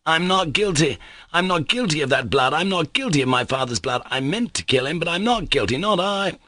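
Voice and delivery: high, desperate protest of innocence; shouting aloud. A narrator's reading. Mitya to the officials, crying out high. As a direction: cry out your innocence to the room. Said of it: "and shouted aloud".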